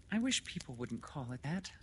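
high, restrained woman's voice; mild objection. Acted. Lenora's first objection to the doctor, mild and high.

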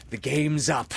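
high passionate cry. A narrator's reading. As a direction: cry it out in dismay. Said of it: "he spoke passionately".

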